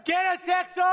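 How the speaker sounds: first, lighter call-out; hype question. Acted. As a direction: rile the audience up. Hosts hyping the audience.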